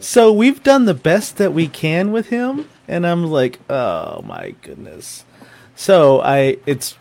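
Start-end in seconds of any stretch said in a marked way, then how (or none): 0.0-2.7 high, slow, careful explaining, rising at the end; groomer's awkward voicemail. Retold or imitated. Groomer tells owner they tried, delicate high voice. 3.7-5.2 dismayed groan. Retold or imitated. Dog owner hears bad news, sinking dismay.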